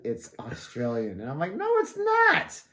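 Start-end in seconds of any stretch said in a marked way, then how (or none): 0.0-1.2 slow, soft, low retort; imitating Jody. Jody judging her British accent, slow low imitation. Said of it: "every time Jody's like". 1.5-2.6 very high, drawn-out mock protest. Rejecting Jody's verdict on her accent, high mock protest.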